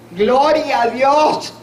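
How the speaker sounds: loud, slow, high cry of praise; a mother praising God at news of a death, exclaiming loudly; joyful praise. Retold or imitated. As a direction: shout it out slowly in joy. Her joyful reply to death news.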